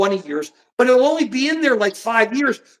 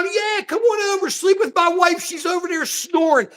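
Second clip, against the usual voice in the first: high mock casual invite; lightly voiced sleazy guy, raised pitch; mocking the men; 7 semitones higher than usual. Host imitating the men, high mock casual voice. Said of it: "fifty guys go".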